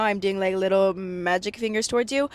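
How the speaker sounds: ordinary talk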